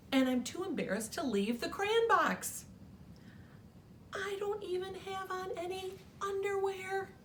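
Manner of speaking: hammed-up bashful crayon plea; Peach Crayon. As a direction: squeak like a crayon caught without clothes. Peach Crayon's embarrassed letter voiced.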